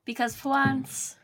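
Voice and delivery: lightly voiced mock French accent; a hint of Clouseau's French accent. Quoting the Pink Panther, light French accent. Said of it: "It's giving Jacques Clouseau".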